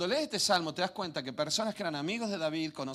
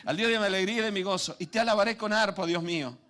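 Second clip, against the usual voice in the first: louder, higher exultant reading; joyful proclamation; 6 semitones higher, 7 dB louder than usual. Real, not acted. Preacher bursts into joyful praise reading the psalm. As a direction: proclaim it with joy.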